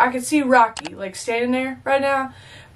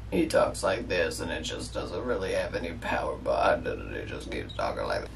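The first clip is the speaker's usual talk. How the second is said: much lower, low-volume mock Rocky voice, talking from the throat; Rocky's throat voice; 11 dB quieter than usual. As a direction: mumble it low from the throat like Rocky, no power behind it. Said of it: "I hate how he talks out of his throat".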